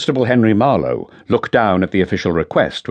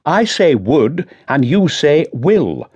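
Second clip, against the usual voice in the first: "would" and "will" drawn out slowly, each leaned on; stress on would and will; 9 semitones higher, 3 dB louder, slower than usual. Acted. Chief Constable correcting Woodend's tenses, stressing would and will. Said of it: "talking in different tenses".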